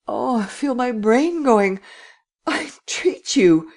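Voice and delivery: high, drawn-out anguished begging; frightened entreaty, near breaking down; terrified pleading. Acted. Terrified lad pleads with the prince.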